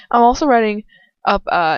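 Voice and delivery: normal talking voice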